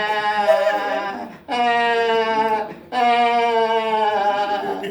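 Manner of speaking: put-on drawn-out sing-song baby wail; mock baby crying. Pinched baby in neighbor's house, mock wailing. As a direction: cry like a pinched baby, hammed-up.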